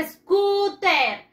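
put-on teacher calls out "Scooter" for the children to repeat; bright model word. Shown as a demonstration. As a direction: call out "Scooter" high for the class. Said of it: "¿Cómo se dice?".

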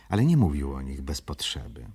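usual speaking voice

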